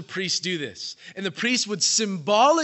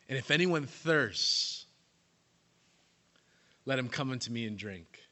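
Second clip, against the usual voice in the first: calm invitation; 5 semitones lower, 6 dB quieter than usual. Shown as a demonstration. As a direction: offer it gently this time. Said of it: "quoting again, contrasted".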